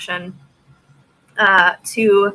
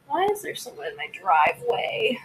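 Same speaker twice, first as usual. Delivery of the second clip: whispered in surprise; startled whisper; 4 semitones lower, 10 dB quieter than usual. Real, not acted. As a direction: breathe it out, alarmed. Spots a stranger in driveway.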